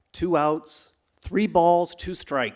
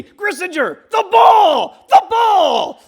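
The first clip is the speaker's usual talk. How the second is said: frantic high shout across the field; yelling; 13 dB louder, much slower than usual. Retold or imitated. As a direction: scream his name and the ball, panicked. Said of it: "yells at me".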